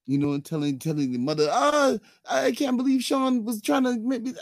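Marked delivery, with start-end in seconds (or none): 1.5-4.3 mock whiny girlfriend. Girlfriend complaining to mother.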